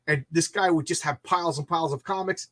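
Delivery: ordinary talk, nothing marked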